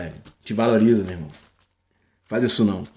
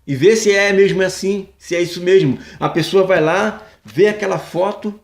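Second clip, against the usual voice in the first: ordinary delivery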